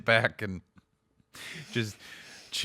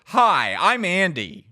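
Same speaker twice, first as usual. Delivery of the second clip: loud, higher mock hello; mocking loud intro; 7 semitones higher, 11 dB louder, slower than usual. Andy parodying friend's version, loud and high. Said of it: "I didn't go".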